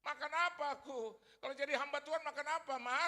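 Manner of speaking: loud, high demand; insolent challenge. Acted. Son asking his mother what he will eat, insolent. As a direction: press mother loudly for an answer. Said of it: "Kurang ajar sama ibu saya".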